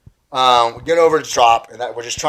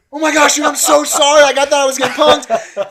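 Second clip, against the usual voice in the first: loud, very high-pitched panicked gushing; frantic apology; 14 semitones higher, 7 dB louder than usual. Retold or imitated. Teen who just called his idol an idiot, frantically apologetic.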